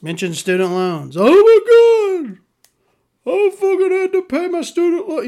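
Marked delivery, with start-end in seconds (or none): 1.2-5.3 mocking whiner. Mocking people complaining about loans.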